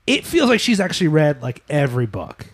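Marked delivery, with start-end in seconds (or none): none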